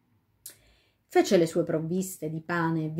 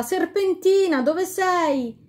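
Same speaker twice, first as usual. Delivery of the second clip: high, loud call into the woods; desperate calling; 11 semitones higher, 5 dB louder, slower than usual. A narrator's reading. The king calls for Serpentina in the forest. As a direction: shout for her while searching.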